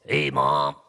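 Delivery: a mild attempt at a puppet voice; boy testing a puppet voice for Mom, lightly voiced; puppet voice try. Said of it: "How's this voice?".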